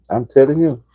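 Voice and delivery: ordinary talk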